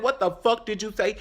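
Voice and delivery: high-pitched confrontational demand; retold angry challenge. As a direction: act out squaring up to him. Acting out a confrontation.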